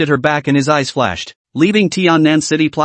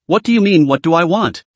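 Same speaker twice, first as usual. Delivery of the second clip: plain reading voice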